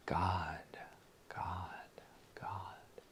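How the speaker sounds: soft unvoiced whisper; whispered God. Shown as a demonstration. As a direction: breathe it inwardly. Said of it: "mentally say".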